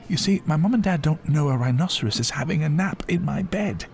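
high-pitched schoolboy explaining; young boy. A narrator's reading. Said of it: "he asked Miss Belltower".

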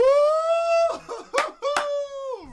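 high squealing laugh and cheer; delighted cheer. Real, not acted. Bandmate cheers loudly after the rap. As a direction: scream with joy at the rap. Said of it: "that was sick".

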